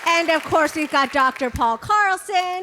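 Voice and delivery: big announcer build-up on the names; hype announcer. Real, not acted. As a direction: call out each name like a ring announcer. Hyping up the directors' names.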